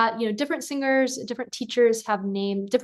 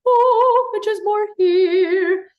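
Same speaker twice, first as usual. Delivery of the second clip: very high, louder, slow operatic ooh; 10 semitones higher, 8 dB louder, much slower than usual. Shown as a demonstration. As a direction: darker opera placement. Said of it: "styles like opera".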